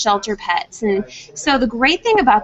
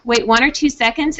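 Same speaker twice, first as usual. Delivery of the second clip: normal talking voice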